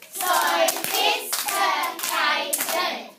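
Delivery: SOPHISTICATED chanted loudly in five beats; loud, very slow classroom chant of SOPHISTICATED; clapped-out syllables. Shown as a demonstration. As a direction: punch each syllable of SOPHISTICATED evenly. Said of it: "Let's clap it out".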